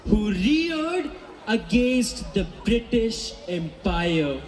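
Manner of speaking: slow, soaring heroic tribute; dramatic declamation. A narrator's reading. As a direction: proclaim a legend's name slowly.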